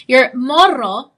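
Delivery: "morro" with a slow rolled R. Shown as a demonstration. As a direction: roll the R in "morro", drawn out. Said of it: "I can roll my R better than that".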